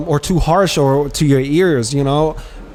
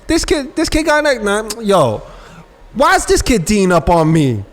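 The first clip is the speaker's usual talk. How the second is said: high, put-on street voice of an irritated player; mock annoyed baller; 7 semitones higher than usual. Imitating a player annoyed at being guarded, high put-on voice. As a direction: sneer it like a baller who can't believe he's guarded.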